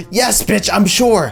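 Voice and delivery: high, loud irritated comeback; hammed-up fed-up snap back; exasperated retort. Snaps back at doubting girl.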